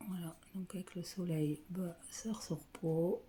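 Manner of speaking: soft, breathy ASMR-style whisper. Real, not acted. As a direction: whisper as if not to wake anyone. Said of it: "je parle pas fort".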